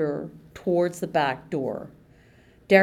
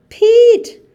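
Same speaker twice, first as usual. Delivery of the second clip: a piercing, very high, very loud scream of a name; screamed call; 16 dB louder than usual. Acted. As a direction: scream for help across the hall. Mandy screams for Pete.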